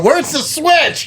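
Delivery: drawn-out high wail of bewilderment; imagined lost friend. Acted. Imagining his friend fumbling down the well, high bewildered call. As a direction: call it out high and slow, as if stranded. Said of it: "he's down there squinting, going".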